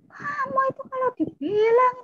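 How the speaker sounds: high-pitched exasperated scolding, imitating older sibling; angry scolding. Older sibling scolds stubborn younger one, high and angry.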